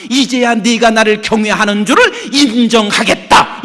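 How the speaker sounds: loud, emphatic declaration; proclaimed divine verdict. Retold or imitated. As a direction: proclaim it to Abraham. God to Abraham, proclaimed.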